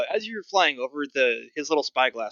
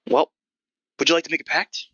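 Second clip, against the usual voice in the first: lightly voiced gruff goblin, pitched up; gruff old goblin; 5 semitones higher, 5 dB louder than usual. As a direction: put on a hint of a crusty old goblin ticket seller. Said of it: "Sort of this gruff old goblin".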